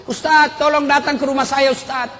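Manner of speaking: high pleading voice of a weeping woman; imitated tearful plea. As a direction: act out a tearful call for help. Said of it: "tiba-tiba ada ibu-ibu menangis".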